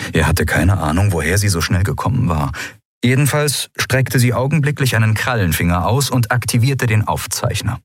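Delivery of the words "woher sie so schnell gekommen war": plain reading voice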